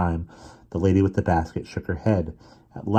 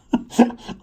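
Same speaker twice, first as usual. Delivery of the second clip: stifled laugh; 5 dB louder than usual. A narrator's reading.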